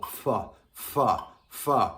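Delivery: "fa" blown out on a strong burst of air; air-only articulation. Shown as a demonstration. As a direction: start each "fa" with air and no tongue. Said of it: "a complete air, articulation, no tongue".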